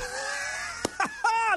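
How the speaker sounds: high burst of laughter, breaking the gloom; sudden laugh. Real, not acted. Laughs off the sad mood.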